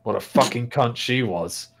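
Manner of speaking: gruff Cornish-tinged orc voice; gruff orc farmer. Acted. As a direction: tell it as a rustic, gruff orc.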